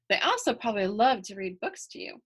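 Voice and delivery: usual speaking voice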